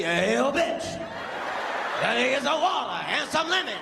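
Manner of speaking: a hint of [name]'s bark, lightly voiced; [name] impression. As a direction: loose [name]-style holler at the waiter. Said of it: "he really talk like that".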